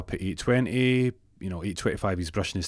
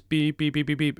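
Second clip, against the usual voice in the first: string of high vocal beeps; high, even beeps by mouth; imitated phone beeps; 7 semitones higher than usual. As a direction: mimic the line going dead. Imitates dead phone line.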